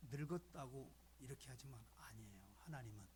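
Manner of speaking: sudden hushed whisper, almost secret. Acted. Pastor whispering a secret to the congregation, hushed. Pastor drops voice mid-sermon.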